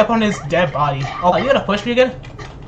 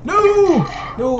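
dismayed cry; 10 semitones higher than usual. Real, not acted. Crying out as he gets knocked off. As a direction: yell as he goes down. Falling off in game.

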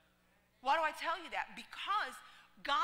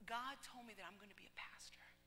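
whispered confession; 12 dB quieter than usual. Retold or imitated. Girl confides her calling.

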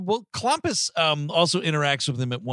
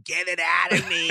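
raised, put-on mock yell; imitated yelling; 4 semitones higher than usual. Podcaster imitates dying man with gold inside him, mock yell. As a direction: mimic a man yelling in distress, pitched up. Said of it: "he's just yelling".

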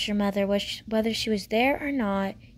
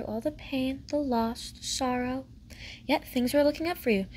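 ordinary delivery

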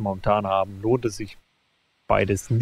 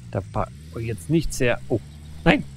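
ordinary talk

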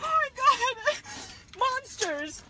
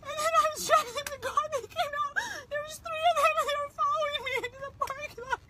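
high, crying words tumbling out; tearful retelling; 7 semitones higher, 3 dB quieter, faster than usual. Real, not acted. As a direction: shaken mother telling a stranger what happened. Tearfully retelling being followed.

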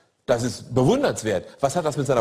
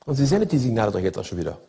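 normal talking voice

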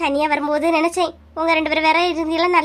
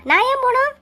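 high, outraged question; shocked high retort on போணும்; indignant; 9 semitones higher than usual. Acted. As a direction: fire back in disbelief at being thrown out. Wife defies eviction.

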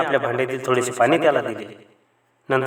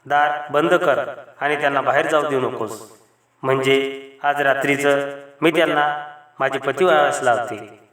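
ordinary delivery, nothing marked

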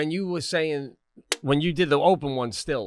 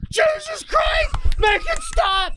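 high, loud, drawn-out horror; panicked shout; 5 dB louder, slower than usual. Retold or imitated. Imagining technicians shouting as his head pops, high and loud. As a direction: scream for it to stop. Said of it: "they're gonna go".

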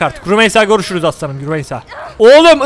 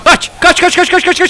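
urgent yelling; 7 semitones higher than usual. Real, not acted. Fleeing enemies, urging himself.

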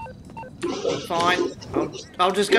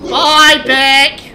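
loud, raised-voice goodbye; called-out farewell; 3 semitones higher, 9 dB louder than usual. Real, not acted. Player sees off a friend in a game, loud called-out farewell. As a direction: call it out across the game.

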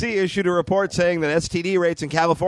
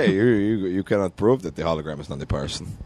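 Elon Musk impression; 10 semitones lower than usual. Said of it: "This might be a good Elon Musk".

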